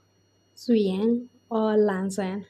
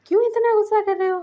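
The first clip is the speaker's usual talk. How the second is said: questioning; 11 semitones higher, 3 dB louder, faster than usual. A narrator's reading. Husband asks why he is angry.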